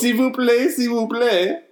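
high, put-on voice pleading s'il vous plaît; mock French caller. Caller trying French on the pizza clerk, high and pleading. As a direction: beg in a high silly caller voice. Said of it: "We gotta go out of character".

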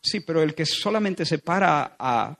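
ordinary talk

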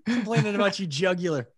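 high, loud, mock-incredulous ribbing; making fun. Buddies scoff at his scratched nose, making fun. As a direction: rib him hard, voice jumping high. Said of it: "we made fun of him".

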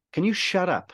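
high-pitched imitation of an exasperated mate. Friend tired of the filmmaker's interruptions, retold exasperation.

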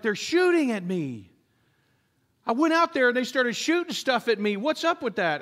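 high-pitched complaint of disbelief; mock outraged soldier. Soldier complains to commander about being shot at, mock indignant. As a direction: play a naive soldier baffled and aggrieved. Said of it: "The soldier who comes up is like".

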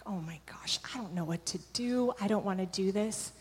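hushed, panicked whisper to herself. Retold or imitated. Whispering to herself beside the hospital bed, panicked. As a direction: breathe it out in a scared whisper.

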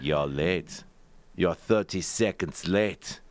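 lightly voiced German driver, slow and low; a hint of a stern German driver; German accent. Driver scolds late passenger in mild German accent. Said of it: "And he'd be like".

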